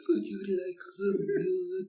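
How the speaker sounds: high, slow spacey robot voice; drawn-out sci-fi computer voice; Doctor Spacelove voice. Shown as a demonstration. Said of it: "should I do, like, Doctor Spacelove".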